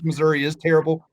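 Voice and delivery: ordinary talk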